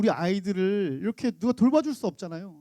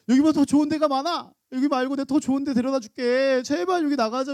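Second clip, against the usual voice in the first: higher, louder, insistent begging; urgent pleading; 6 semitones higher, 4 dB louder than usual. Retold or imitated. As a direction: drag mother out of there. Daughter begging mother. (엄마를 데리고 나가려 조르는 딸, 높고 크게)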